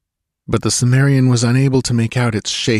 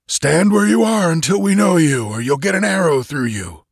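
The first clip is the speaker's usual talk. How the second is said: high, harsh sentry's challenge; 10 semitones higher than usual. A narrator's reading. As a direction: bark out a wary challenge. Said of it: "challenged a harsh voice".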